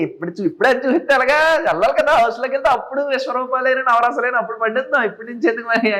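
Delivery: high-pitched comic character voice; acted comedy. Guest performs funny rasa on request, high silly comic delivery. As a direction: ham it up for laughs, pitched well up. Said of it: "చాలా ఫన్నీగా హాస్యం".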